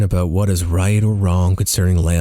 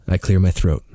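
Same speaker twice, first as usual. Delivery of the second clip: usual speaking voice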